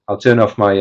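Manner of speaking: ordinary delivery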